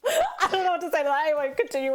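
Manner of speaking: high laughing words; flustered laugh. Real, not acted. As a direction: laugh through it, caught off guard. Caught off guard, laughing.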